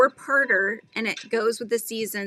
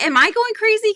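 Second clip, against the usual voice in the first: mock alarm; 12 semitones higher, 6 dB louder than usual. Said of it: "I was like".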